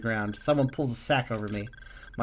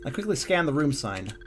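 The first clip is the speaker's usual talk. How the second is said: normal talking voice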